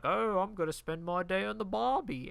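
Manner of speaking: mock a corny joke delivery; mimicked joke voice. Mimicking the movie's shrimp joke.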